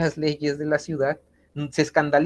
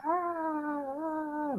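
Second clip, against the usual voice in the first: little retold cries of panic, pitched high; mock panicked screams; 7 dB quieter, much slower than usual. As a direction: imitate a fleeing crowd crying out. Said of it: "la gente corre y grita".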